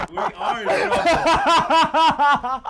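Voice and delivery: high, slow and laughing hard; can barely get the words out for laughing; wheezing with laughter at the punchline. Real, not acted. Repeats the line, cracking up.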